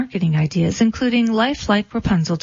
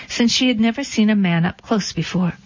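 usual speaking voice

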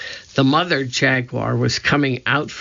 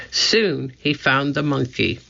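ordinary delivery, nothing marked

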